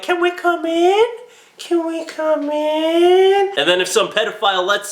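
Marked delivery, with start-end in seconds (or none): 0.0-1.0 put-on high, eerie kid voice; creepy child voice. Disguised aliens at the door, eerie high child voice. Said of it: "they're like". 1.6-3.5 very slow, high, stretched eerie plea; drawn-out creepy child. Acted. Disguised aliens at the pedophile's door, slow eerie high plea. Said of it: "they're like".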